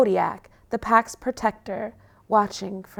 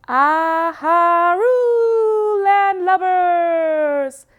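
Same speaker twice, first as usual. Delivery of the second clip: slow, high, loud pirate howl; husky pirate howl; 9 semitones higher, 6 dB louder, much slower than usual. Acted. Puppy pretending to be a pirate on the mast, howling loudly. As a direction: howl it out like a playful pirate dog. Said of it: "he howls in his best husky pirate voice".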